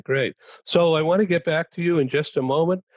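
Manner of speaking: usual speaking voice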